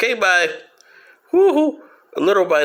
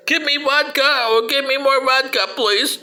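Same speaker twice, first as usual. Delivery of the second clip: mock Yeltsin; 7 semitones higher than usual. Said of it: "Well, what a guy".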